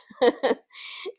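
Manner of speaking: a loud rising laugh at herself; bright burst of laughter. Real, not acted. Laughing at own indecision.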